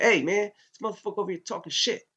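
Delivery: angry yell, high and quick; heated anger. Retold or imitated. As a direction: rattle it off hot and riled up to the sergeant. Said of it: "I'm fucking hot".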